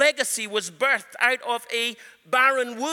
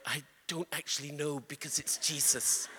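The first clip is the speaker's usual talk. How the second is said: quiet aside; 5 semitones lower, 10 dB quieter than usual. Retold or imitated. Preacher's reply in retold deliverance.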